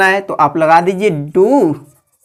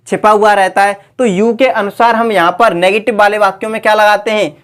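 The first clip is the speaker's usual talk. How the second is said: ordinary talk, nothing marked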